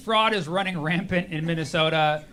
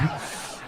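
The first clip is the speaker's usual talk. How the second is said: breathy laugh; 10 dB quieter than usual. Real, not acted. After own joke.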